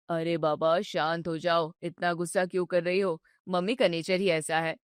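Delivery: calm her down patiently; soothing husband. Acted. Husband soothes his upset wife, low and gentle.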